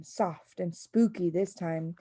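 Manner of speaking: ordinary delivery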